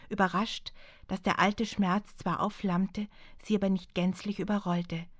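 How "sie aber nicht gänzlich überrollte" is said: plain reading voice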